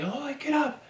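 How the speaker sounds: high-pitched imitation of screaming drill instructors; mock screaming. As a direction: mimic a scream in a high, strained voice without full volume. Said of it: "my drill instructors were screaming at me".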